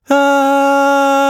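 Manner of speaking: loud, high scream of fright; wild-ride screaming. A narrator's reading. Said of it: "they cried".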